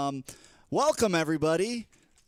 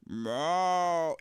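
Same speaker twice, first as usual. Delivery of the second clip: mock cat meow; 3 semitones higher than usual. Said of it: "he's just like".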